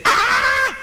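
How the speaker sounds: sharp, high, shrieking outburst cry; a sudden screech of temper; angry shriek. Retold or imitated. Controlling friend blowing her top, high shriek. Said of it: "she would say".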